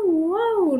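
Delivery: high siren wail imitation; nee-naw ambulance siren, playful. Shown as a demonstration. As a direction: sing out a siren sound for a child. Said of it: "por ejemplo, el, la ambulancia".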